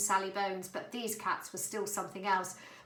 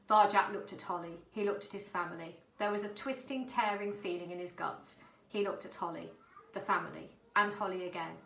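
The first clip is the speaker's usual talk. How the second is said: plain reading voice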